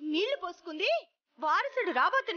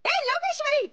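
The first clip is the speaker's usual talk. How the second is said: high, loud summons; angry calling out; 8 semitones higher, 5 dB louder than usual. Acted. Angry woman summoning Lokeshwari from inside the house, calling out furiously. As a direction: shout the name to summon her.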